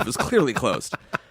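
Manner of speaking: ordinary talk, nothing marked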